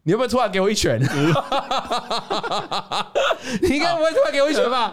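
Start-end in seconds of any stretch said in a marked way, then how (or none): 1.0-4.9 higher and quick through laughter; giggling nervous tease; laughing. Real, not acted. Nervously teasing the co-host about a punch, laughing.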